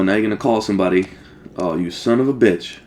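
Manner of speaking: ordinary delivery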